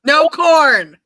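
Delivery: loud, high-pitched exclamation; emphatic outburst. Real, not acted. Cohost objecting to corn on pizza, emphatic shout.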